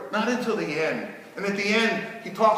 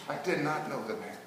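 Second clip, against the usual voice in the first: quiet denial; 4 semitones lower, 10 dB quieter, faster than usual. Acted. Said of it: "I said".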